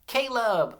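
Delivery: Caleb high and loud, in one piece; high, loud Caleb with a falling end; name called out. Shown as a demonstration. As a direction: call out Caleb whole for the class to echo. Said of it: "say this name with me".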